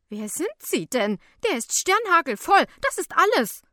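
loud, high, agitated woman; indignant calling out. A narrator's reading. Woman challenges helper on the train, shrieking indignantly. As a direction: call it out, rising in pitch and volume. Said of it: "ruft die Frau".

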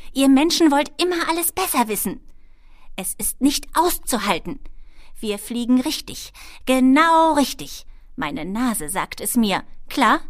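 high, loud tirade in kobold voice; spitting kobold irritation. A narrator's reading. Kobold rails at know-it-all humans, angrily spitting it out. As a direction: scold loudly, drawing out the insistence. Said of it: "fauchte sie".

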